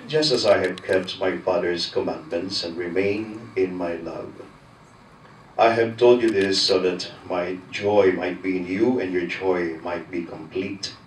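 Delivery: ordinary talk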